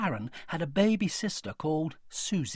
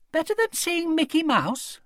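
doubtful; 13 semitones higher, 4 dB louder than usual. A narrator's reading. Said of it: "said Connie doubtfully".

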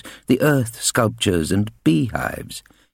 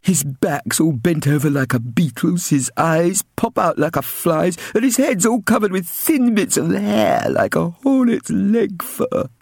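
cruel bully; 11 semitones higher than usual. Acted. Bully boy mocking the old man, cruel sneer. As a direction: spit it out cruelly. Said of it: "Ginger continued cruelly".

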